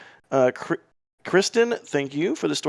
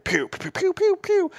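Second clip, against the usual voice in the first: playful ninja pew-pew sounds, last pew squeaked high; Ninja Decimator voice. Retold or imitated. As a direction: zap out each pew like a toy blaster. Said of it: "you have to do that when you do Ninja Decimator's voice".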